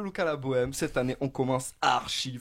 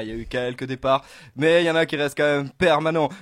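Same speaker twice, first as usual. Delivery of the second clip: ordinary talk, nothing marked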